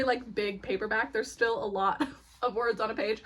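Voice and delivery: ordinary talk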